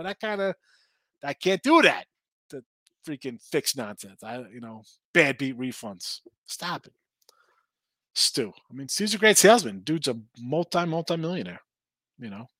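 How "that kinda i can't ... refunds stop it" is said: ordinary delivery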